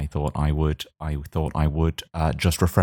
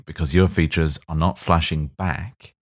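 normal talking voice

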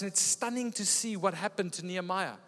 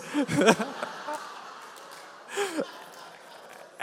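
breathy laughter; 10 semitones higher than usual. Real, not acted.

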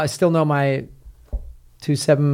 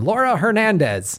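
Laura Hernandez in plain American English; gringo pronunciation; 4 semitones higher than usual. Shown as a demonstration. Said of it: "the gringo version".

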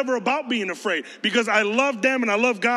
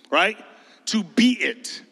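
ordinary talk

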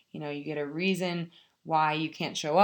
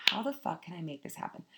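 much softer, said almost to herself; quiet inner question; 9 dB quieter than usual. Retold or imitated. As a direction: murmur the question under your breath. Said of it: "And I was like".

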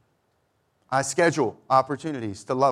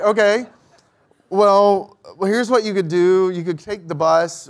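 forced helpfulness; 6 semitones higher, 7 dB louder than usual. Retold or imitated. Forced helpful reply to stranger.

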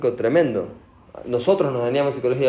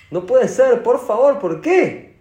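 much higher and louder, desperate cry; beg, voice rising in anguish; anguished plea; 10 semitones higher, 5 dB louder, slower than usual. Retold or imitated. Noah cries out to Hashem, louder anguished pleading. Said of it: "empezó a llorar".

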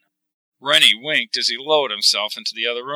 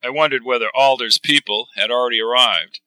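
usual speaking voice

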